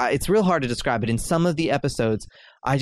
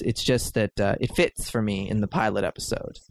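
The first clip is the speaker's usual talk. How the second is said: usual speaking voice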